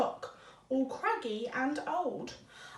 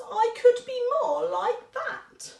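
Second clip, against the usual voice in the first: mouse wishing; 7 semitones higher, 3 dB louder than usual. Acted. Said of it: "thought Mouse".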